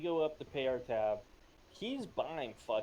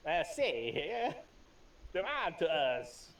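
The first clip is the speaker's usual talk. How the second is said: gangster voice; 5 semitones higher, 4 dB louder, slower than usual. Acted. Joins the gangster bit.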